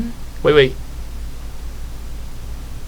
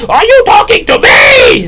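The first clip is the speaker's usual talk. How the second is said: high furious shout; Pumbaa; 7 dB louder than usual. Retold or imitated. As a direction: explode in rage. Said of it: "what Pumbaa did in The Lion King".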